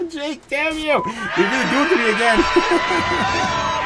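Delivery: high, loud mock outrage, slowing down; playful shout. Real, not acted. Streamer yelling at a chatter who baits him. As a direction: yell at the viewer in fake anger. Chat teases him to call.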